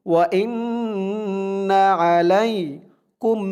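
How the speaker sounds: slow, even chant of the phrase; Quran recitation. Shown as a demonstration. Teacher reciting the verse for students to repeat, slow chant. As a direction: draw out the words as recitation.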